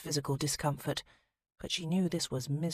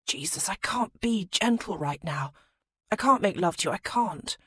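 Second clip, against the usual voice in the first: breathless; 4 semitones higher, 5 dB louder than usual. Acted. Aroused, breathless plea.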